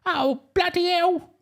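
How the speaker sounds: high, slow, hammed-up Cockney; exaggerated high Cockney exclamation. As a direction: squawk it as a mock-Cockney voiceover. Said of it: "I don't think it works in a Cockney voice".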